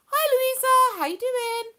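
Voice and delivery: high, loud singsong hello; big high-pitched welcome; excited greeting. Real, not acted. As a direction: call out brightly to someone arriving. Calling out to a viewer joining.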